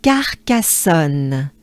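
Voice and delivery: Carcassonne drawn out slowly, syllable by syllable. Shown as a demonstration. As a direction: stretch out Carcassonne. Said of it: "with a good breath".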